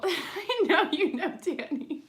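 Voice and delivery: laughing I know you know, Danny. Real, not acted. Laughing at the repeating signs.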